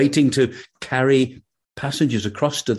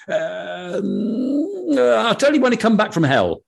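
put-on cocky young man; 9 semitones higher than usual. Young man's cocky answer.

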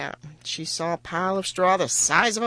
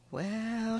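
soft, drawn-out hesitation; slowly; 3 semitones higher, 7 dB quieter than usual. A narrator's reading. Daughter stalls the king as she recalls, speaking slowly. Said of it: "she said slowly".

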